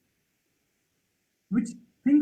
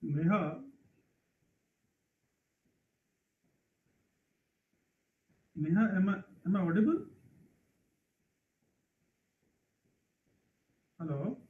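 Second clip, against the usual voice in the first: barely audible; 5 dB quieter than usual. Real, not acted. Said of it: "Sir, your voice is, uh, very low".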